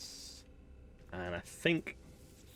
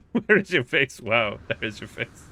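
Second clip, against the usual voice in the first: amused disbelief; 3 semitones higher, 9 dB louder, slower than usual. Real, not acted. Player laughing at alien's face, amused disbelief. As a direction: blurt it out between real laughs.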